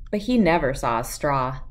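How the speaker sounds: usual speaking voice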